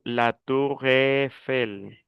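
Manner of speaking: slow "la Tour Eiffel", EI said as one long open E. Shown as a demonstration. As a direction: draw out "Eiffel" as "èfel", French style. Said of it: "la E con la I es E". ("la Tour Eiffel" lento, con E abierta)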